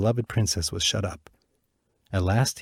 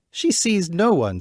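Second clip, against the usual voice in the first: slow, higher wicked queen voice; suspicious stepmother plotting; scheming queen; 10 semitones higher, 4 dB louder, slower than usual. Acted. Wicked queen to her daughter, slow and suspicious. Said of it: "said the queen".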